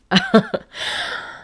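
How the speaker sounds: very high-pitched burst of laughter; incredulous laugh. Real, not acted. Vlogger recalling the husband's instructions, high incredulous laugh. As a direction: let out a high, helpless laugh.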